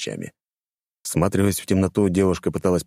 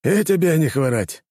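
hearty greeting; 10 semitones higher than usual. Acted. Gorky greeting.

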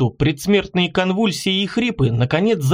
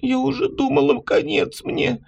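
put-on weak girl voice; 8 semitones higher, 3 dB quieter than usual. A narrator's reading. Beaten girl voiced by narrator.

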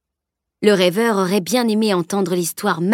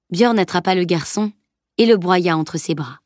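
ordinary delivery

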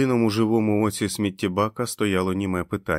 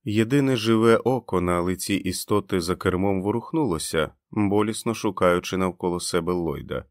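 ordinary delivery

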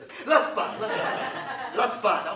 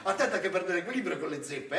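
ordinary talk

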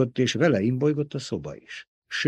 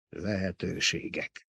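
low, slow, quiet mutter; 4 semitones lower, 9 dB quieter than usual. A narrator's reading. Baron dismissing Peter's guesses, muttered. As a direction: mutter it slowly under your breath. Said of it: "mormogta a báró".

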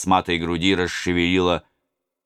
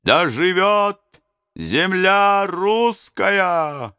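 high, very slow, loud chorus, «земля» drawn out; crowd echoing; 13 semitones higher, 3 dB louder, much slower than usual. Acted. Robbers echoing the prince, high slow loud chorus. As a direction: roar it back as a crowd. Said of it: "повторили разбойники".

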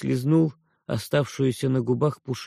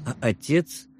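ordinary delivery, nothing marked

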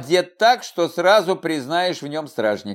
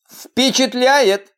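coolly; 7 semitones higher, 4 dB louder, slower than usual. Acted. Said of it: "хладнокровно заявила девушка".

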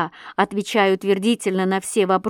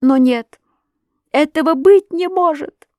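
high, loud denial; anguished cry; 8 semitones higher, 7 dB louder than usual. A narrator's reading. Wife rejects the accusation, anguished cry. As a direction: cry out in refusal to believe. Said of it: "закричала она опять".

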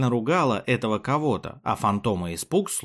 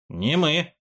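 quick higher-pitched protest; exclaimed denial; 6 semitones higher than usual. A narrator's reading. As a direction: blurt out an innocent denial. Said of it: "одновременно воскликнули Фантома и Спук". (поспешное восклицание-отрицание)

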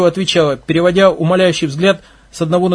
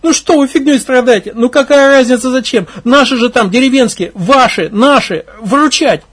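high, loud tearful plea; girl begging the lads near tears; desperate pleading; 7 semitones higher, 5 dB louder than usual. Acted. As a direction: cry out for them to help.

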